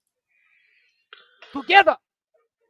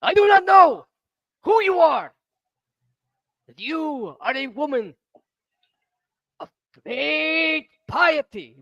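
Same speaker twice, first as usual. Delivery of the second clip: dopey cartoon bellow; 12 semitones higher than usual. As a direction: bellow it like a big ogre. Character booming speech.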